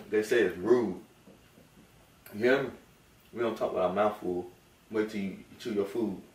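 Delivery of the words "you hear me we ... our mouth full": normal talking voice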